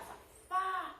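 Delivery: safari sung out high on a long FA; stressed syllable drawn out. Shown as a demonstration. Teacher showing it is the fa, high stretched stress. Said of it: "Então, é o fa".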